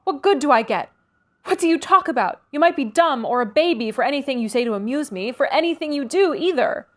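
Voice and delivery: irritated. A narrator's reading. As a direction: belittle him, voice rising. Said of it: "growing more irritated".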